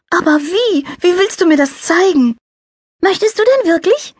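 high, light angel voice; little angel voice. A narrator's reading. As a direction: play a cheerful little angel. Character voices.

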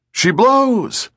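high, drawn-out call from the masthead; a sailor hailing the deck from aloft; distant lookout call. Acted. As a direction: sing it out across the deck.

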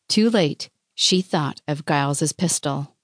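usual speaking voice

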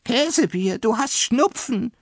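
high-pitched alarmed cook; horrified high exclamation; startled cry. A narrator's reading. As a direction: cry out in shock, voice shooting up. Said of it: "rief die Köchin Kümmel erschrocken aus".

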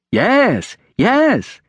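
put-on high, eager boy's cry; enthusiastic. A narrator's reading. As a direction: confirm it with high-pitched glee. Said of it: "his eyes bright with enthusiasm".

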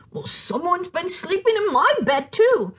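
a mother bear noticing her bed; Mama Bear. Acted. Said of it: "said the Mama Bear".

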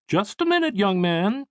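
high-pitched motherly check; mother halting her son. Acted. Mother to son as he slips away, high-voiced checking. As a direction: stop him in a high mother's voice.

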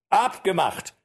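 high accepting call. Acted. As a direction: call out the bet. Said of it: "rief der Jäger".

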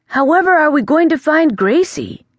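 desperate to find her; worried. Acted. The prince asks how they'll find Gracie, worried.

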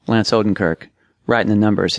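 plain reading voice

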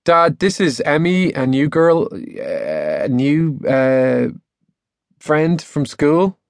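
hesitant, slowing as he searches; awkward introduction. A narrator's reading. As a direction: fumble for the right word. Embarrassed boy fumbles an introduction.

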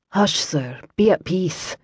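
soft, quiet, soothing Scots murmur; calm him like a frightened patient; soothing hush. Acted. Kira soothes the bound, injured Liam, softly hushing.

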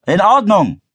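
high, loud answering call; calling back. A narrator's reading. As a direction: shout your okay back, pitched high. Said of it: "rief ihr Vater zurück". (laut zurückrufend)

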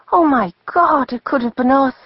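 put-on high, shocked young woman. A narrator's reading. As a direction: gasp it out in horror at the near miss. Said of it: "The girl had her hand over her mouth in shock".

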